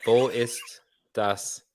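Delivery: slow, careful German; German attempt. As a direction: sound out the German slowly. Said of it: "oh, fuck".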